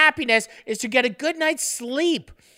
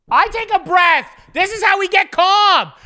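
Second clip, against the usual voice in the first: ironic yell, much higher and louder; hammed-up angry calm; mock shout; 8 semitones higher, 7 dB louder than usual. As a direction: bellow the word calm as a joke. Mock-shouting about being calm.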